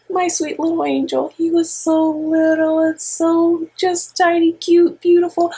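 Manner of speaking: high, doting coo over her little angel. Real, not acted. Mother gushing to her brother about her toddler, high doting coo.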